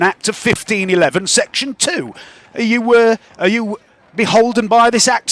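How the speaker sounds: a stuffy bureaucrat quoting statutes; high, prissy official voice; pompous guard. Acted. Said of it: "and he says to you".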